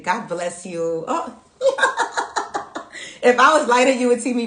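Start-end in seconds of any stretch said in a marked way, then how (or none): 1.6-3.2 breathy squeaky giggle, very high; giggle, flustered by the compliment. Real, not acted. Blushing at a compliment.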